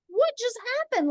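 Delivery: high, fast, baffled question; reliving the moment of total confusion; stunned disbelief. Retold or imitated. Retelling seeing the chicken vanish, fast high bewildered question. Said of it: "I was like".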